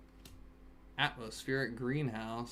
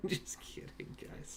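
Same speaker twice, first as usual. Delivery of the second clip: hushed whisper to chat; 11 dB quieter, slower than usual. Real, not acted. Dad whispers to chat so his teething kid sleeps, whisper. Whisper after loud yell.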